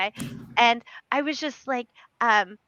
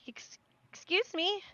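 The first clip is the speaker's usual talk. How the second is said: soft, higher, hesitant approach; timid interruption; 6 semitones higher, 11 dB quieter than usual. Acted. Professor gently approaching praying women, timid interruption. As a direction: interrupt very gently so nobody is startled.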